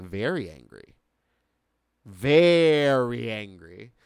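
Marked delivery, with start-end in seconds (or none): 2.0-3.9 VERY stretched out long and loud; drawn-out emphasis. Real, not acted. Podcaster stressing how mad the wait made them, drawn-out emphasis. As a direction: lean hard and slow on VERY.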